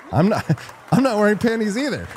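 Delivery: laughing. Real, not acted. Host jokes back at a viewer, laughing. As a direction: crack up mid-joke.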